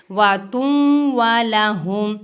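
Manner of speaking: वा तुम वाला हुम with short unstretched vowels; short vowels, recited. Shown as a demonstration. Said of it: "ज़बर ज़ेर पेश को बिल्कुल भी लंबा नहीं करना". (छोटी हरकतें, बिना खींचे)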